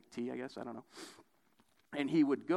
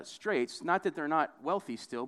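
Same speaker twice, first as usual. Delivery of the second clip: ordinary delivery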